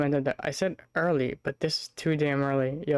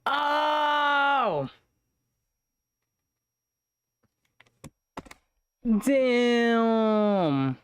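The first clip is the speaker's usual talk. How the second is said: excited; 8 semitones higher, much slower than usual. Real, not acted. Reacting loudly to video.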